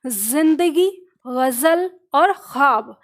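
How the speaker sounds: जिंदगी, गजल, ख्वाब with Urdu z and throaty kh; slow Urdu sounds in जिंदगी गजल ख्वाब; Urdu pronunciation. Shown as a demonstration. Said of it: "Urdu में इनका सही उच्चारण". (उर्दू का सही उच्चारण)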